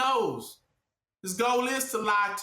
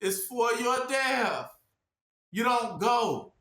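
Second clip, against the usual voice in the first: preaching shout; 4 semitones higher, slower than usual. Real, not acted. Preacher driving warning home.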